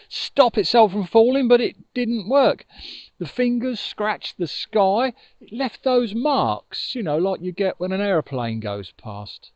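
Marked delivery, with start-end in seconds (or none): none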